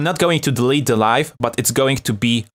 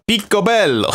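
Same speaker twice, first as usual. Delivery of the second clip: Italian flair; 9 semitones higher than usual. Shown as a demonstration. Showing off Italian word.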